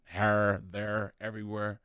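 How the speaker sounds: lightly shown Saint Louis accent. Shown as a demonstration. Host showing the Saint Louis accent they had to lose, Saint Louis accent. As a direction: show off the Saint Louis vowels. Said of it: "me coming from Saint Louis".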